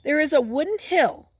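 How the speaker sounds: put-on slow read-aloud voice for kids; story reading. Teacher reads a picture book to children, slow storytelling. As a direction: read it slowly to the class.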